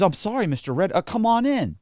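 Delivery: high-pitched acted politeness; apologetic clerk. Title clerk inviting the client in, high polite apology. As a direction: switch to a high, accommodating office voice.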